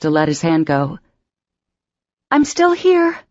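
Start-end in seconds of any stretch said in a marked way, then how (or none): 2.3-3.2 much higher, pleading cry; tearful cry. Acted. Emma crying out as Patrick is loaded into the ambulance, tearful. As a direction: cry out through tears. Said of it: "she cried".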